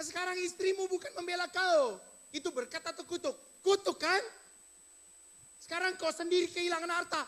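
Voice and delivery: loud, high jeering questions; taunting shout. Acted. Job's friend jeering at Job's misfortunes, loud taunting. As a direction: rub each loss in his face, shouting.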